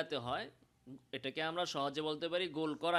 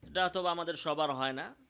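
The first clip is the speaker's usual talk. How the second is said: ordinary talk, nothing marked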